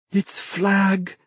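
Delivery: high, frightened gasp of recognition; panicked thought. A narrator's reading. Dennis waking to a dark shape, panicked. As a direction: wake in terror, voice pitched high. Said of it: "Dennis's sleep-fuddled mind thought".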